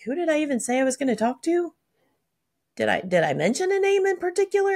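high-pitched puzzled self-questioning; mock bafflement. Speaker replaying forgetting a plan hours later, baffled. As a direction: act out being baffled, pitched well up.

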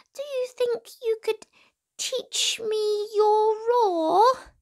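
high, squeaky, hesitant pleading; a tiny mouse daring to wake a lion; nervous mouse voice. A narrator's reading.

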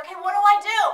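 loud, high exasperated question; retold yell. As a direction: call it up to the guide. Climber yelling up to guide.

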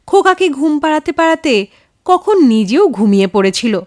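usual speaking voice

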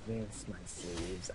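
low, soft, slow menacing villain; Shadow voice. Acted. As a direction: murmur it like a dark commander. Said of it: "I can only do the shadow voice sometimes".

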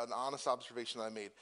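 ordinary talk

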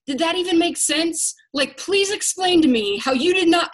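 high, loud sarcastic demand. Retold or imitated. Mom mocking her kid's choice with sarcastic scolding. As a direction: drip sarcasm, pitch way up. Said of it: "that's exactly how I sound".

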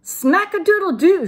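high, loud gleeful shout. A narrator's reading. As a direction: holler it like a rooster crow. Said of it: "shouted the kitty boy".

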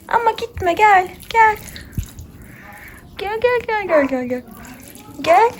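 high, pleading coo to an animal; pet talk. Real, not acted. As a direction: coax the calf to come closer. Calling the calf over. (yüksek, yalvaran tatlı ses)

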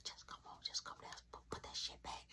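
hushed, hurried whisper; urgent whisper. Retold or imitated. Hurrying brother downstairs at night, urgent whisper. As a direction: whisper him along, hurry. Sneaking, house asleep.

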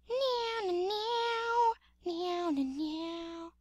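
high, soft cat-like party tune; thin high meows, falling away; high singsong meowing. Pretend. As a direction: mew a little tune up high.

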